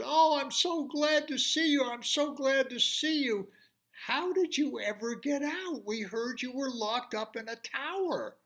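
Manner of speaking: high, glad king's welcome; relieved king. Acted. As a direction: greet the lost son gladly. King greets lost son.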